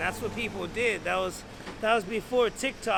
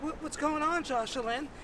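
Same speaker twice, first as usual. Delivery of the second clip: a hint of a worried mother, pitched up; lightly voiced mom; 7 semitones higher than usual. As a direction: question your son in a slightly raised pitch. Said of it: "And she's like".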